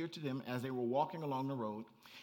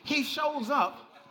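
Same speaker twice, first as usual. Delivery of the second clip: higher and louder, building to shouted hey hey hey; preaching at full force; 10 semitones higher, 11 dB louder than usual. Acted. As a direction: preach it up to a roaring climax. Preaching climax, rising to shouts.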